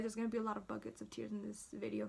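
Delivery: ordinary delivery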